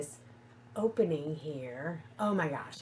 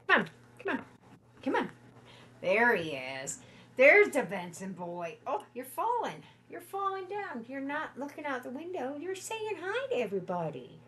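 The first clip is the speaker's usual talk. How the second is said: much higher coaxing pet voice; 7 semitones higher than usual. Real, not acted. To her dog, coaxing him to say hi, high baby talk.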